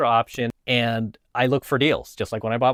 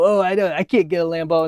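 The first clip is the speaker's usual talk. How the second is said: higher-pitched mock complaint; upset hater; 5 semitones higher, 3 dB louder than usual. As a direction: lightly imitate someone griping in self-pity. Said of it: "why he's so upset".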